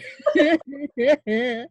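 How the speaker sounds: a laugh spilling into the line; loud laugh. Acted. Helena laughing at herself as a bear, loud. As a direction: laugh at your own despair.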